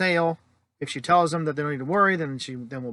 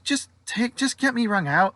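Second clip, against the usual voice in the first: higher-pitched, louder impatient plea; exasperated customer; 9 semitones higher, 4 dB louder than usual. Retold or imitated. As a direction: act out a customer cutting the clerk short. Impatient customer cuts clerk off.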